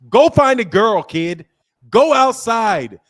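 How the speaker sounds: loud, high shout. Real, not acted. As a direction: yell it at him. Yelling advice at caller.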